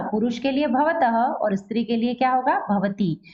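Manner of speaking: ordinary talk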